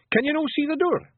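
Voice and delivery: very high Scottish question; urgent. Acted. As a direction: press her frantically for an answer. Urgent Feegle leader presses girl.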